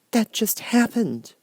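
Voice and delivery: put-on just state it, a small girl; simple little Dorothy. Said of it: "returned Dorothy simply".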